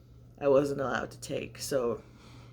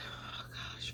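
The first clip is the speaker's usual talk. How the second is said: breathy, much softer sigh of relief; whispered relief; 14 dB quieter than usual. Retold or imitated. Mother retelling finally breathing through one nostril, whispered relief.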